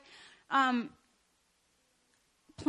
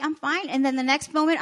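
ordinary talk, nothing marked

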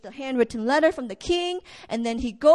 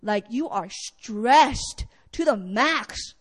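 ordinary talk

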